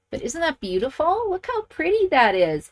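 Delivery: high, gushing delight; admiring the finished card with a pitch-high thrill. Real, not acted. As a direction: gush over how pretty it is.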